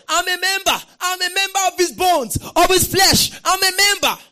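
high, very loud cries of revelation; full-voiced ecstatic proclamation; exultant shouting. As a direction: shout it out as if running everywhere with the news. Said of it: "I was running everywhere".

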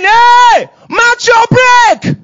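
very high, loud drawn-out yell; full-voiced shout, words stretched out. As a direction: bellow an urgent warning from heaven. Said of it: "Heaven is shouting".

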